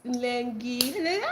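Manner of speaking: drawn-out gentle greeting; sweet greeting imitation. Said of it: "You just be like".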